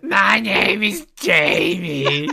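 high, stretched-out mimicry of the wife; Jamie impression. Co-host copying the wife impression, high silly voice. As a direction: drawl it slowly in a mock wife voice. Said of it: "imitating Jamie, where I do the voice".

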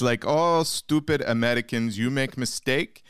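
broken English in a put-on Russian accent; mock Russian accent. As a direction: play a cartoon Russian host mocking Yankees.

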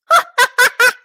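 high yipping laugh from the throat; sharp high yip of a laugh. Shown as a demonstration. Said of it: "laugh through your throat".

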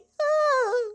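a soft, teary sigh; tearful sigh. Acted. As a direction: sigh as if holding back tears. Emotional goodbye to Greg.

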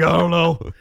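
helpless mock yell of a friend caught out; clueless friend. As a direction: blurt it out like a baffled friend. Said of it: "And he's like".

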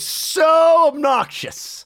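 "so" stretched long and loud before "obnoxious"; high, held stress on "so"; emphatic. Real, not acted. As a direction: lean hard on a drawn-out "so". Stressing how obnoxious he was.

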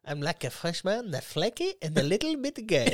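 put-on high comic French accent; joking impression in a French accent; mock French accent. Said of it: "directions in a French accent".

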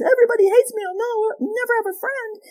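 put-on high, whining child voice; a child wailing that nobody likes them; whiny child.

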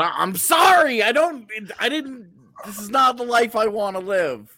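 high, loud comic wail; mock anguished outburst. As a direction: plead it loudly, hammed-up and high. Mock anguish over admitting an opinion.

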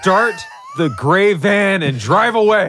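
loud, high, slow mock command; mock getaway shout. S0 shouting a joke order at the hosts, loud and slow. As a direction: bellow it like a movie villain fleeing.

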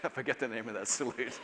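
ordinary talk

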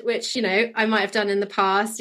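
usual speaking voice

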